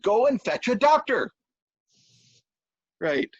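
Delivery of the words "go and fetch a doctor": high, insistent order; exasperated high-pitched demand; angry wife. Acted. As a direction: send him off with a scolding command.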